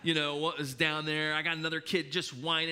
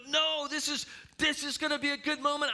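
insistent dad; 9 semitones higher than usual. Retold or imitated. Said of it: "And I'm going".

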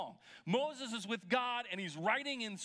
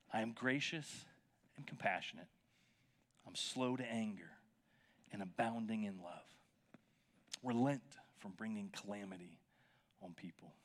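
hushed God's voice; 11 semitones lower, 5 dB quieter than usual. Acted. God's self-description, hushed and low.

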